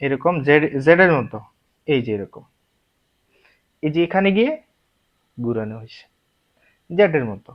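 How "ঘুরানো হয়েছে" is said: ordinary talk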